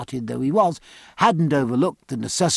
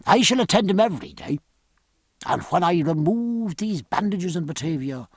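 reassuring doctor. Acted. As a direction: promise a full recovery as the physician.

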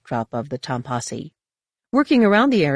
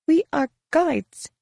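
high, slow, sour guide; measured rebuff in a high woman's voice; 11 semitones higher, slower than usual. A narrator's reading. Guide rebuffs Jacen's history question, sourly. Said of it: "Daric said sourly".

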